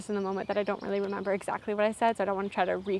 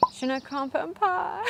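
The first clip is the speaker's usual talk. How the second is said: high, slow, sing-song helpless French; self-mocking, higher-pitched French reply; feeble mock French; 7 semitones higher, slower than usual. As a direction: squeak it out slowly as a hopeless beginner. Imagined panicked attempt at French answer.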